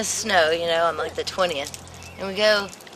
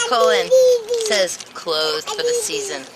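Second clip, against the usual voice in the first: dismayed; 12 semitones higher than usual. Real, not acted. Finding farm closed.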